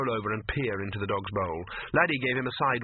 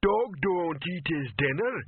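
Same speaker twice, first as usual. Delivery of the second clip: slow, lumbering simpleton, pitched up; Detritus; 7 semitones higher, slower than usual. A narrator's reading. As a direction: plod through it word by word. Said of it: "came detritus's voice".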